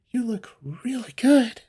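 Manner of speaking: high, slow, airy compliment; breathless gushing. Acted. Feline hugging an old acquaintance, airy gushing. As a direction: gush it high in a half-whisper.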